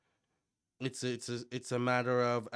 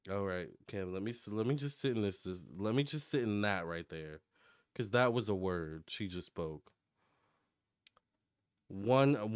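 ordinary delivery

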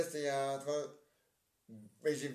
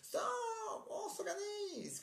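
shouted call; slower than usual. Acted. Actor shouts to rally the stuck group, high shout. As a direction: call the group to order with a yell.

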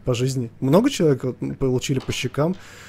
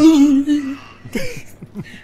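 high frightened shriek at a jump scare; startled yelp of fear; scared scream; 13 semitones higher, 7 dB louder than usual. Real, not acted. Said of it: "напуган просто до усрачки". (испуганный вскрик)

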